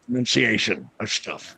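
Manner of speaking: speaking through clenched teeth; talking through teeth. Shown as a demonstration. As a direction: talk through the teeth, jaw tight. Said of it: "talk through my teeth".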